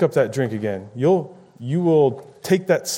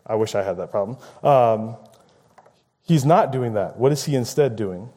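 ordinary talk

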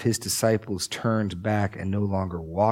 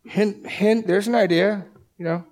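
sarcastic hinting; 10 semitones higher than usual. Acted. Crowd hinting Jesus should repeat the bread miracle, high teasing. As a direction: nudge him with a mock wink.

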